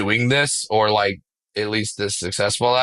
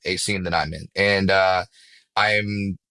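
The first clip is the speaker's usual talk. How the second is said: usual speaking voice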